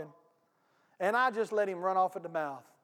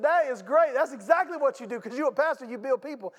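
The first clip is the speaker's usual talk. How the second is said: higher, louder, delighted caller; delighted lady; 5 semitones higher, 4 dB louder than usual. Retold or imitated. Lady on the phone delighted by his answer, higher and louder. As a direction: voice her happy surprise, higher and louder. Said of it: "she starts laughing on the phone".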